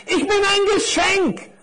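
very high, squeaky little character; little parcel. Acted. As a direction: announce yourself in a high little voice. Little parcel proudly introduces itself. (hohe Stimme eines kleinen Päckchens)

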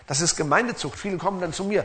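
ordinary talk, nothing marked

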